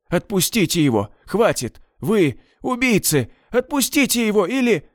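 крик выше и выше, срываясь в конце; кричи на палачей, умоляя отпустить; отчаянный крик. A narrator's reading. (Медведь в отчаянии кричит на палачей, всё выше)

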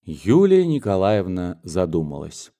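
usual speaking voice

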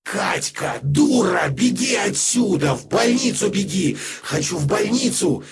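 hoarse demonic male screaming, high; frantic shouting hostage taker. A narrator's reading. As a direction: scream in raw hysterical rage. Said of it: "Хриплый, мужской ... надрывно кричал".

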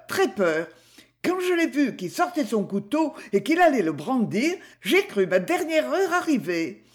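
lightly voiced shopkeeper hamming up her terror; dramatic butcher's wife. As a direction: play up the horror for the journalists. Butcher's wife tells her fright.

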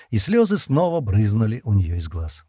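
plain reading voice

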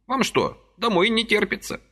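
put-on high fussy old man's voice, gently reproaching; a little old man chiding kindly; old man reproaching Vasily for his haste, high and fussy; reproachful old man. A narrator's reading.

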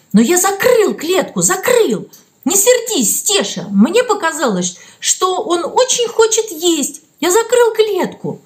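high, insistent protest; heated. A narrator's reading. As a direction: plead hotly to be believed. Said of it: "воскликнул горячо". (горячо, с жаром)

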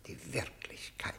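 breathy whispered echo; barely voiced, to himself; whispered, as if lost in memory. Prisoner echoes word under breath.

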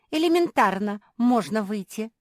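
loud, angry retort. A narrator's reading. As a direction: snap back in anger. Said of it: "рассердилась Наретта".

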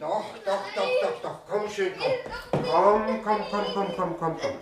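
sing-song coaxing father; coaxing child. Acted.

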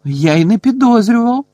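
high, loud amazement; impressed exclamation. A narrator's reading. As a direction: exclaim as if bowled over. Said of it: "вражено вигукнув Лі".

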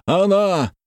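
high drawn-out cry; high urgent call; sudden shout. A narrator's reading. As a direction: cry out in shocked recognition. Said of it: "вдруг крикнул староста".

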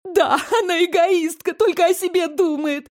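high whiny sob; всхлипывать высоким плаксивым голосом; sobbing whine. Acted. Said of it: "всхлипнула". (плаксивое всхлипывание дочки)

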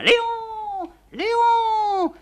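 long high screeching call; peacock call. Acted. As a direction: imitate the peacock's cry. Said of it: "le paon appelle".

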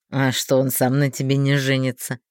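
low, sly insinuating man; insidious. A narrator's reading. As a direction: slip the question in slyly. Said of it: "коварно спросил Тонкий".

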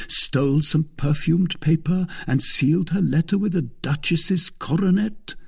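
string the guesses out teasingly, rising; teasing D'Artagnan. Acted. D'Artagnan teases about the letter.

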